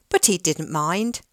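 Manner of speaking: ordinary delivery, nothing marked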